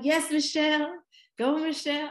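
high, loud cheering for a favourite singer; root for her like a fan at a concert; excited fan cheer. Retold or imitated. Cheering on Michelle in videos.

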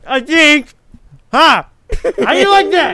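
high, loud mock triumph; gloating cackle. Mocking his friends, high loud gloating. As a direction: crow over the others.

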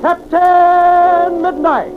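ordinary delivery

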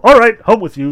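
loud brisk order; commanding. Acted. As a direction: send him home with a bark. Azazello dismissing Veranuka.

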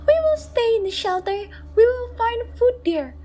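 high, gentle mother's voice; soothing mother. Acted. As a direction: hush a frightened child. Mother hides her doubt.

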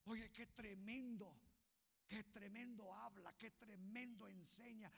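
ordinary talk

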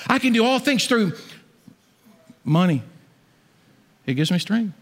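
high, mocking misquote of the verse; misquoting the promise as a jab at greed; mock wrong verse. Shown as a demonstration. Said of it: "No, I can do all things through Christ".

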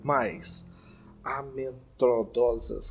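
very slow, ominous presenter delivery; suspenseful announcement. Acted. Host hyping the scary urban legends, slow suspense voice. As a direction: stretch each word for suspense. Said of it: "tá tocando uma trilha de suspense".

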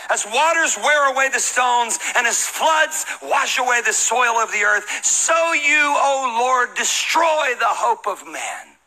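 loud, high, drawn-out lament; big, stretched preacher's proclamation; dramatic scripture reading. Acted. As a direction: cry it out slowly to heaven. Reads Job's lament aloud dramatically.

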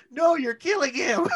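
high, loud mock plea; mock fan yell. Mocking a fan yelling at the ring, high and loud. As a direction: imitate a fan begging them to stop. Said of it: "mock fan yelling".

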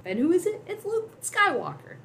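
high, drawn-out announcement of a hero; playful big reveal. Shown as a demonstration. Storyteller revealing who climbs from the floor, playfully triumphant. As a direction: stretch out the name like a grand reveal.

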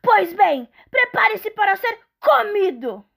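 loud, high menacing troll. Acted. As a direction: threaten to eat him, troll-like. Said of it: "disse o troll".

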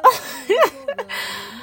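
high-pitched laugh. Real, not acted. Laughs while opening the video.

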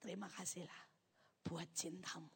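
low, very soft prayer; murmured gratitude with long pauses; hushed thanks to God. Retold or imitated. Believer thanking God after the accident, hushed and low. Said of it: "saya bilang ini".

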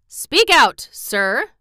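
loud, high-pitched royal command. A narrator's reading. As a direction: order it forcefully, starting high. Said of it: "he commanded".

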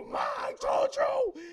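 very high, slow excited shout; triumphant calling to mom. Retold or imitated. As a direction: drag it out in a high gloat. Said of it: "I go".

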